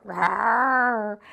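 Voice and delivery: low playful mock roar; bear roar. Shown as a demonstration. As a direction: growl it low, like a friendly bear. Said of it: "Can you roar like a bear".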